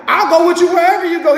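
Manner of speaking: fast, high, eager promise; the scribe blurting out his pledge to follow. Retold or imitated. Scribe to Jesus, rushing out an eager high promise.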